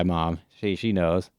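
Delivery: usual speaking voice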